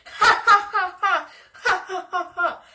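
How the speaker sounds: loud, high imitated cackle; high-pitched, blaring mock laugh; mocking hard laughter. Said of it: "like, laughing really hard".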